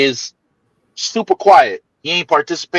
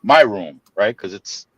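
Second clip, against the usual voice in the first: ordinary talk, nothing marked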